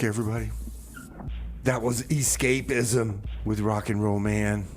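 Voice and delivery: normal talking voice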